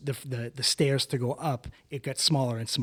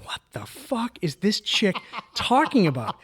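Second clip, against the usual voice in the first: incredulous; 8 semitones higher, slower than usual. Retold or imitated. Incredulous inner reaction quoted.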